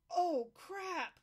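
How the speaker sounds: loud, high, dawning shock; retold shocked realization. Friend spotting twins in the pictures, loud shocked blurt. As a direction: blurt it out as the penny drops.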